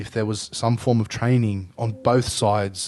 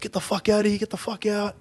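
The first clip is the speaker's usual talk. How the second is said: mock telling off; 9 semitones higher than usual. Guy in the footage ordering the group off, mock-angry, higher. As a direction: voice the guy shooing the group away, pitched up. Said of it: "And this guy's like".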